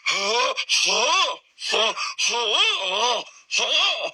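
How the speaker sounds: high, whooping gasps for air; repeated high wheezing whoops, hammed-up; imitated choking noise. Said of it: "making a noise that I've never heard".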